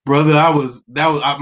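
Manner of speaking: ordinary talk, nothing marked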